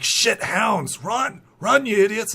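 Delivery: high, loud alarmed shouts; panicked yelling. Acted. Bandits fleeing the hounds, high panicked shout. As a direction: yell at them to flee.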